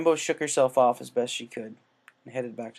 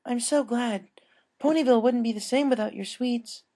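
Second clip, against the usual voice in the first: higher, pleased voice; warmly glad, pitched up; glad customer; 12 semitones higher than usual. Acted. Customer reassuring the baker, pleased and higher.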